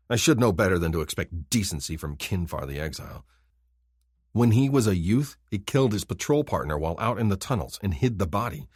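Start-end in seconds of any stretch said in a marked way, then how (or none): none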